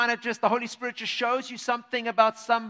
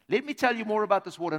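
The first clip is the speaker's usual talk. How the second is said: quicker repeat; 3 dB louder, faster than usual. Acted. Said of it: "he says".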